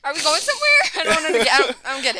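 confused, giggling throughout; laughing confused. Retold or imitated. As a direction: play dumb through laughter.

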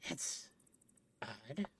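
softly puzzled nerd; quiet nerd voice. Acted. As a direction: murmur it, baffled. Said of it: "get my nerd voice on".

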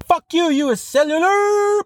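very high, slow, shouted curse; enraged customer's final curse; furious shout. Retold or imitated. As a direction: scream it at the company. Reading the rant's climax.

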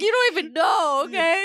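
mock hurt. Voicing the hurt man.

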